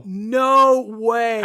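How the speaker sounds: loud, drawn-out amazement; real disbelief. Real, not acted. Fan hears who played the body, loud disbelief. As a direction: blurt it out, floored. Stunned by surprise cameo news.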